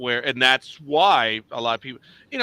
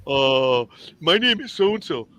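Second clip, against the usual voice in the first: pointed self-introduction; 6 dB louder, slower than usual. Shown as a demonstration. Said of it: "I would turn around and tell 'em".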